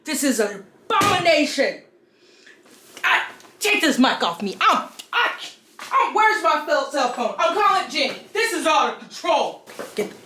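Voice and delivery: outraged prudish character, hammed up; hammy stage actor. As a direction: overplay a scandalized conservative. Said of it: "thank you if you watched through my terrible skit".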